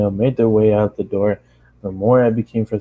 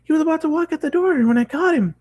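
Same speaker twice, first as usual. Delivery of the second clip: pony insisting to owner, very high; determined pony; faster than usual. Acted. Pony continues.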